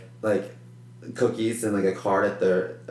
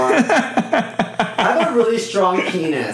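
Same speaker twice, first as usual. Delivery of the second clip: much higher, slow, laughing brag; laughing mock boast; 10 semitones higher, 4 dB louder, slower than usual. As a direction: brag absurdly while laughing.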